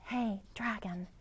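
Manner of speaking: soft, hushed call; soft whisper. Acted. Elizabeth checking whether the sleeping dragon stirs, softly whispered. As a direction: gently test if he's asleep. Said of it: "Elizabeth whispered very softly".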